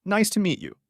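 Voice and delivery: normal talking voice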